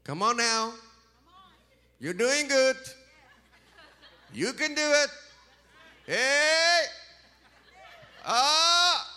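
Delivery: high, loud hype shouts; cheering congregation. Retold or imitated. Preacher imitates American churchgoers cheering on a singer, high and loud. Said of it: "What do y'all do?".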